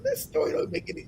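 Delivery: hushed, nearly whispered thought; private thought. Retold or imitated. Speaker recalling doubts about the story, privately whispered. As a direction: murmur it as if to yourself. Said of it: "I'm thinking to myself".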